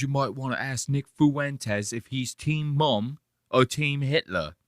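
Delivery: lightly voiced British guest; a hint of a posh British uncle; mock British voice. Streamer quoting the British guest, in a light mock British voice. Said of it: "He goes".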